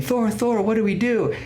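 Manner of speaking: high-pitched mock pleading; imitated panicked crowd. Crowd begs Thor for direction, high mock panic.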